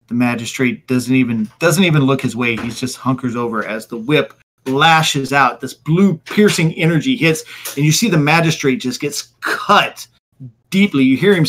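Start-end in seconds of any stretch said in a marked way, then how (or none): none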